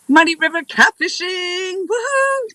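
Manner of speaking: high, drawn-out whooping shout-out; excited cheer. Real, not acted. Streamer greeting a lucky-charm viewer, excited high cheer. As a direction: call out the name high and long, ending in a whoop.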